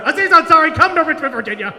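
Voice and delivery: loud, high call-out; mock challenge. Comic calling out Aziz on the recording, mock-belligerent shout. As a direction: shout a showy challenge to a rival.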